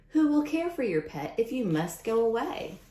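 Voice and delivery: ordinary talk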